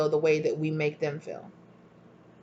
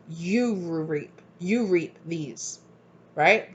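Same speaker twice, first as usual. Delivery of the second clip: ordinary delivery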